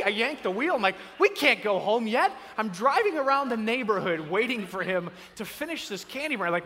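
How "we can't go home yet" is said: raised, loud, urgent outburst; acted exasperated cry; panicked dad. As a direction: burst out in alarm.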